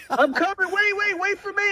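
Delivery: very high, silly little straggler voice; naive soldier. Retold or imitated. Naive guy running into battle with helmet over his eyes, silly high voice. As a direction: squeak it like a hapless tagalong hero. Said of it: "And he's like".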